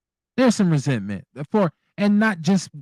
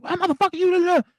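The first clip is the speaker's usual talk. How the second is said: high-pitched mock tirade, words tumbling out; mock angry outburst. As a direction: act out someone blowing up, pitch shooting way up. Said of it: "they'll blow their shit like".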